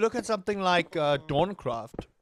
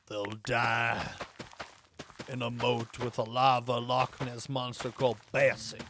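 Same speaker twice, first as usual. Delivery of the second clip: put-on terrible southern accent; 6 semitones lower, 3 dB quieter than usual. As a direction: ham up a bad American southern accent. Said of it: "I'm using my southern accent".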